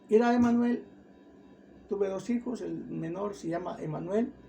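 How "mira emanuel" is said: higher, louder call to get someone's attention; raised-voice retold call. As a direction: imitate a relative calling someone over. Daughter-in-law calls Emanuel's attention.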